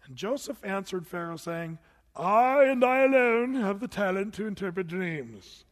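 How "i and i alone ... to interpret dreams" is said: loud, proud bragging voice; a loud, self-important claim; boastful tempted answer. Pretend. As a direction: boast it like a show-off. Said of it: "That's what I'd be tempted to say".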